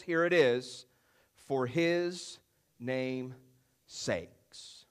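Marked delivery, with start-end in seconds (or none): 1.3-4.8 "for His name's sakes" with long pauses between the words, each one sinking lower and softer; slow it down and let "sakes" drop almost to nothing; weighty emphasis. Shown as a demonstration. Preacher pressing home the key phrase to listeners, slow and dropping. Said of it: "Don't miss that".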